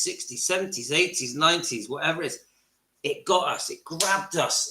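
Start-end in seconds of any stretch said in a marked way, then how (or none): none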